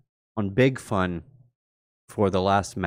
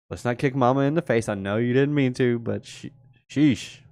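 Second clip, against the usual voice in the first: ordinary talk, nothing marked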